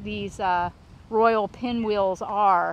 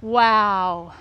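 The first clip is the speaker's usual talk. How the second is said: loud awestruck wow; awed wow; 7 dB louder than usual. Real, not acted. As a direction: gasp it out in awe. Seeing a huge specimen.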